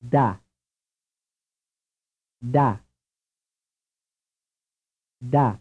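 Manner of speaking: Da with a dental d and open "ah"; isolated Italian Da, pure vowel; Italian syllable. Shown as a demonstration. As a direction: say Da with tongue on upper teeth. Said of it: "The following is an Italian syllable".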